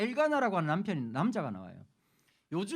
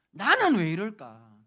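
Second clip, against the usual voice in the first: slow, louder sigh of a worn-out believer; mock lament; 3 semitones lower, 8 dB louder, slower than usual. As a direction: groan it out like life is too hard. 속상한 신자 흉내. (속상한 신자가 한탄하듯 천천히)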